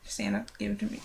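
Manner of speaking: breathy whispered aside. Real, not acted. Hushing aside to the camera.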